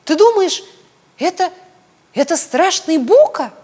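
high, loud alarmed squeal; piping, panicky little piglet; agitated squeal. A narrator's reading. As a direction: squeal it in fright, breaking it up. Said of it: "взвизгнул от волнения".

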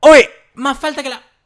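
high, loud outraged protest; high angry shout. Real, not acted. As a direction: shout at the foul call. Protesting another foul.

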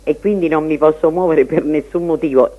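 ordinary talk, nothing marked

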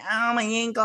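high-pitched whiny cry of pain; comic high yelp of injury; mock pain. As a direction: imitate a hurt boy wailing. Acting out boy hurt by fall.

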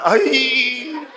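ay agudo y risueño; quejido agudo. Retold or imitated. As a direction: suelta un ay de sorpresa. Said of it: "Y el man". (quejido agudo del hombre)

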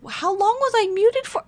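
high-pitched upset wail; high, distraught question; distressed, near tears. Real, not acted. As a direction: voice shoots up in real dismay. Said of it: "I'm gonna cry".

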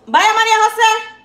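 high, loud summoning shout; calling out. Real, not acted. Cook calling María José for her order, high loud holler. Calling someone to serve food.

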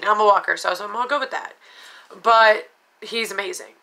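usual speaking voice

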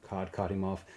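plain reading voice

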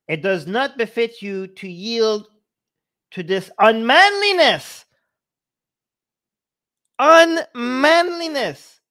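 rising to a high, loud mock outrage; declaimed indignation. Reader quoting Krishna's rebuke of Arjun, incredulous declaiming. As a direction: build up and blare out the last word.

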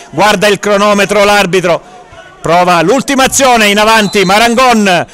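usual speaking voice

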